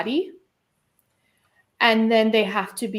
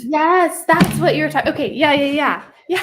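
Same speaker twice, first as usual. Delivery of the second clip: excited recognition; 6 semitones higher, 8 dB louder than usual. Real, not acted. Excited recognition of host's point.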